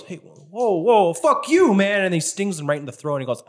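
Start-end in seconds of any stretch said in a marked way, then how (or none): 0.0-2.0 high mock-indignant voice, growing louder; offended bee retort. Game master as other bee retorting, mock indignation. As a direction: protest it high, then push louder.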